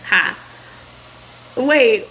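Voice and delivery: usual speaking voice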